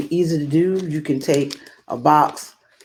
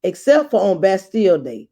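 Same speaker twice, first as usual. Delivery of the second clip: story narration; 3 semitones higher than usual. A narrator's reading. Guest reader opening the book for young listeners, storytelling. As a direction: read it like a picture book for kids. Starts reading the story aloud.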